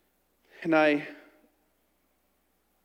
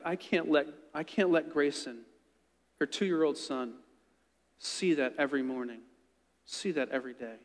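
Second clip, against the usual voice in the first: mild retold weeping; 4 semitones lower, 7 dB quieter than usual. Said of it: "she just begins to weep".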